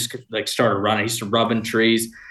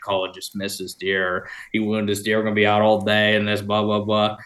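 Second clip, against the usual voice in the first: usual speaking voice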